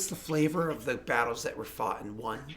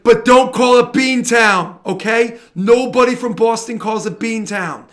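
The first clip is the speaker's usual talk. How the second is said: loud, slow, indignant Boston local; mock indignant Bostonian; 6 semitones higher, 11 dB louder than usual. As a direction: plead it loudly, slowly, like a touchy Bostonian. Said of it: "I'm like, and like".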